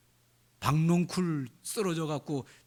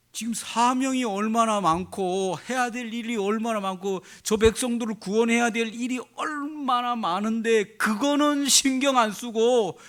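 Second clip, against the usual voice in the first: impassioned rebuke; 7 semitones higher, 5 dB louder than usual. Real, not acted. Preacher rebuking, rising passion.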